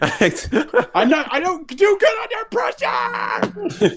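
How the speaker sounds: high, strained, drawn-out panicked wail; mock panic. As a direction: crack up into a hammed-up screech. Panics jokingly at being made host.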